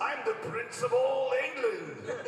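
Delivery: mock Vegeta. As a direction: puff up like a pompous royal, drawn out. Fan voices Vegeta at panel.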